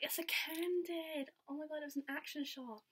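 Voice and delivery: high, drawn-out retold exclamation; playful mock excuse. Performer excusing a spoiled photo, high playful protest. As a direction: imitate a performer talking up the photo.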